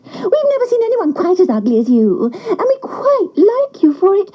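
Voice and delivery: high, cheeky duck voice; wild ducks teasing. A narrator's reading. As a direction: tempt him along like a sly duck. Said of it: "they said".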